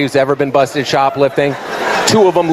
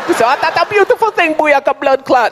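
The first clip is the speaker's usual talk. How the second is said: high, hammed-up Jamaican patois; Jamaican accent; 11 semitones higher than usual. Talk of the expected baby at the door, high Jamaican accent. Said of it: "the Jamaican security guard".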